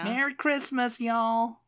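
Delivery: high-pitched imitation of a Southern accent. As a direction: echo a Texan greeting in a Southern accent. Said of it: "But then they would say".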